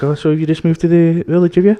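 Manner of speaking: lightly put-on village voice; mimicked village shopkeeper. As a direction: imitate a nosy local shop woman. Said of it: "she was having an asthma attack".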